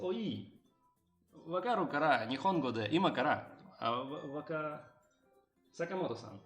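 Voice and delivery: ordinary delivery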